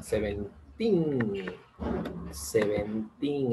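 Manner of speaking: put-on slow Seventeen, the teen stretched long. Shown as a demonstration. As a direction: draw out Seventeen, hold the final teen. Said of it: "Seventeen es más larga la pronunciación". (Seventeen lento, alargando el teen)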